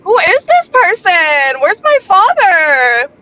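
can't believe this is her dad talking; stunned disbelief. Retold or imitated. Daughter hearing her calm father, stunned and loud. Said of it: "And I was like".

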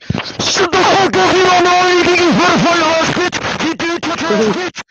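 full-blast high-pitched screamed tirade; screaming trash talk. Acted. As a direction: scream abuse at the top of your lungs. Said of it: "just screaming absolute trash talk at him".

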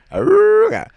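very high, loud "awooga" honk; imitated car horn. Co-host mimicking a 1950s car, loud horn noise. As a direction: hoot it like an old-time horn.

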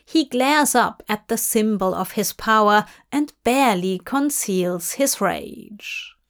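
ordinary delivery

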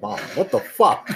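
slow, incredulous mock outburst; exasperated. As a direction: disbelief at mom's question, half laughing. Exasperated at mom calling.

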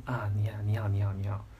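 whispered, meek little hellos; mock fawning whisper. Chairwoman meekly greeting the speaker, in a whisper. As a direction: hush it like a humbled boss greeting you.

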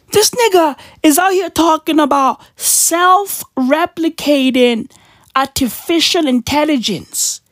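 normal talking voice

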